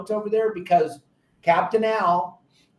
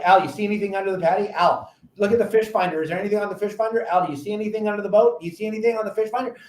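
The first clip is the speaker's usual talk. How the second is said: rapid-fire questions, no breath between; impatient nagging; 3 semitones higher, much faster than usual. Retold or imitated. Impatient angler pestering Al about a bite, rattled off fast. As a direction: pester your buddy nonstop. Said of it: "and I go".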